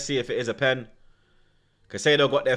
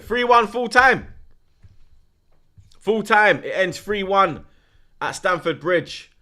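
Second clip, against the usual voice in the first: mock commentator; 6 semitones higher, 5 dB louder, slower than usual. Announcing the final score.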